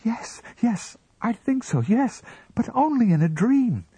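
very high, pressured reply; flustered admission. A narrator's reading. Wife yields to husband's questioning, flustered. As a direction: give in, protesting at a high pitch.

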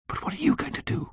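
high, breathy frightened question; fearful. A narrator's reading. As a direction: ask in a hushed, scared voice. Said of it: "asked with huge fear in her face".

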